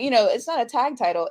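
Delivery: usual speaking voice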